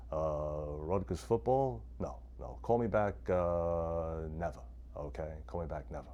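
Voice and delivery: low, quiet, dismissive radio-host brush-off; Mike Francesa impression. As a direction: wave off the caller, bored and curt. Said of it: "what's he gonna say?".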